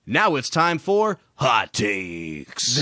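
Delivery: slow, drawn-out announcer intro; fighting game announcer. Shown as a demonstration. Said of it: "do you want me to give a good intro".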